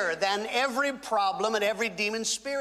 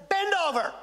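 "Bend over." said higher and louder, insisting; insistent repeated command; 8 semitones higher, 4 dB louder, much faster than usual. Retold or imitated. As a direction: order her again, higher and louder, not taking no. Repeats the order to the woman.

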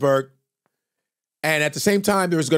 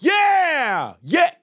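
crowd cheer; 13 semitones higher than usual. Retold or imitated. Cheering ringside for their boxer, yelling. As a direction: cheer hard for the fighter.

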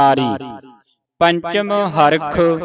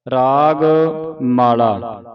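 normal talking voice

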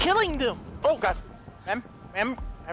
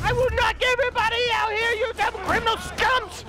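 hero taunt; 9 semitones higher than usual. Acted. Hero yelling at enemies.

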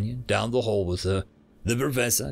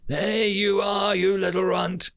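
delighted exclamation; 8 semitones higher, 4 dB louder than usual. A narrator's reading. Ishtar finding her runaway student, pleased exclamation. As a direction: exclaim it with pitch well up. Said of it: "The last sentence was exclaimed".

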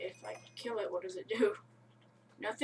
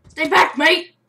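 loud, high playful yell; mock warning to a monster; laughing shout; 5 semitones higher, 17 dB louder, slower than usual.

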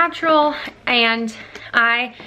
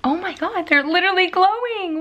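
normal talking voice

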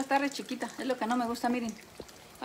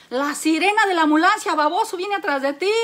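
mock scolding; 4 semitones higher, 6 dB louder than usual. Acted quarrel, wife insults husband.

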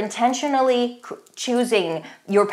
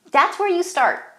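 emphatic high; 10 semitones higher than usual. Shown as a demonstration. Repeated for emphasis.

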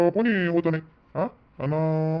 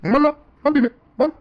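high, loud startled question; startled shout; 9 semitones higher, 6 dB louder, slower than usual. Acted. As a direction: jump in alarm and shout who. Startled by shouting over firewood. (दचकून मोठ्याने विचारलेला प्रश्न)

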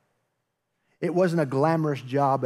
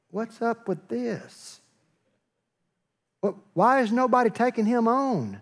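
higher-pitched, mock indignant question; indignant disbelief; 8 semitones higher than usual. Young David to soldiers hiding from Goliath, indignant disbelief. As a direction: question it high, in acted disbelief. David challenges the cowering army.

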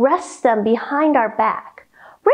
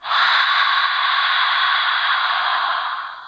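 long, open-mouthed breath out; foggy exhale. Shown as a demonstration. Yoga teacher showing kids winter breath, a long foggy exhale. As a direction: breathe out like fogging a window. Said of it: "Deep breath in through your nose".

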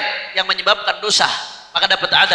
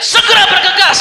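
high, raised-voice command; acted urgent order; 11 semitones higher, 5 dB louder than usual. Preacher voicing Iblis hurrying his minions, urgent high command. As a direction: urge the troops into action.

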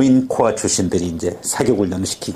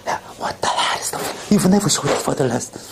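slow, hushed then rising film line; movie courtroom role-play; 5 semitones higher, much slower than usual. Acted. Learner role-plays a movie courtroom scene, hushed and intense. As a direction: act out the angry lawyer scene slowly. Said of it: "자기가 롤플레이를 하는 거야".

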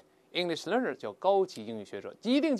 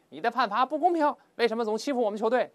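high, loud indignant complaint at the referee; angry protest; 9 semitones higher, 5 dB louder than usual. Acted. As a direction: protest like a furious coach. Teacher voices angry coach protesting.

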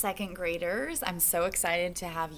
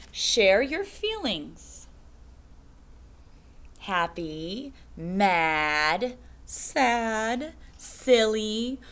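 slow, sing-song picture book reading; reading to children; 5 semitones higher, 4 dB louder, much slower than usual. Acted. Teacher reading a picture book to second graders, slow sing-song. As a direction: stretch each line out for the kids.

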